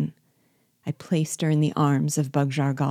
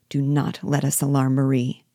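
ordinary delivery

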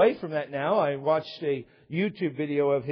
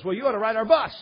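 lightly hammed-up, higher and louder pitch; mock-enthusiastic invitation, punched at the end; eager church recruiter; 5 semitones higher, 6 dB louder, faster than usual. Retold doorstep church pitch.

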